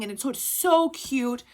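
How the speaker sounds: usual speaking voice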